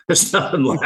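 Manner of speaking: a grim laugh through the words; rueful laugh at a dark fact. Real, not acted. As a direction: laugh it out, high and bright.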